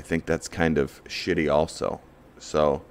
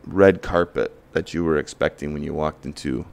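ordinary talk, nothing marked

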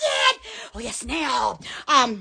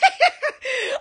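real high-pitched giggling; high giggle; 7 semitones higher than usual. Real, not acted. Host trying to resume the pitch, high giggles. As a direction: giggle helplessly between words. Laughing fit goes on.

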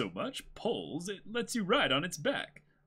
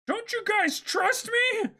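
much higher, slow and pleading; Luffy voice; 5 dB louder, slower than usual. Acted. Luffy protests to crewmates, high and slow. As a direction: whine it like a wounded boy captain. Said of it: "You do, Luffy".

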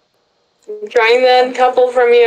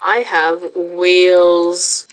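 slow voice; 3 semitones lower, much slower than usual. Pretend. Slow character voice.

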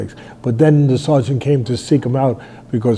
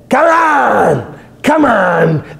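high, loud defiant scream; shouting at a hurricane, much higher and louder; screaming into the storm; 9 semitones higher, 3 dB louder, much slower than usual. Retold or imitated. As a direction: yell it up at the sky from the mast. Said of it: "he's screaming".

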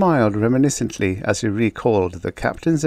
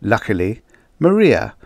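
usual speaking voice

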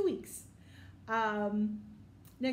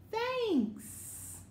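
friends' thanks; 10 semitones higher than usual. Acted.

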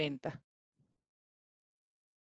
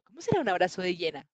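ordinary delivery

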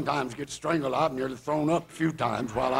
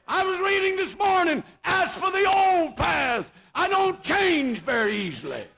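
high, loud, drawn-out pulpit cry; fiery preacher in full flight; full-force preaching; 13 semitones higher, 4 dB louder than usual. Real, not acted. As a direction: preach it hard, pitched way up.

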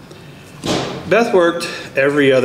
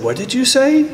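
much higher, startled retold question; shocked dad; 12 semitones higher than usual. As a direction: ask it like you can't believe your ears. Father stunned by the question.

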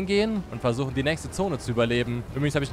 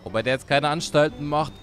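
ordinary delivery

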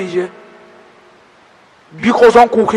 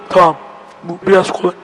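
ordinary talk

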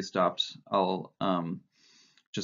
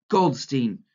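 put-on raised, loud boyish yell; bellow; 5 semitones higher, 6 dB louder than usual. A narrator's reading. Boy calling after Winston as door closes, bellowing. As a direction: bellow the name as an insult. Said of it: "yelled, bellowed the boy".

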